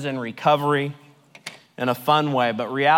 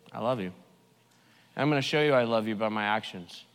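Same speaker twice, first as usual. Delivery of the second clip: much softer, low, tender promise; tender voice of God; 7 semitones lower, 9 dB quieter, faster than usual. Retold or imitated. Pastor voices God telling the sinner he loves them, soft and tender. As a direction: speak God's love gently. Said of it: "God said".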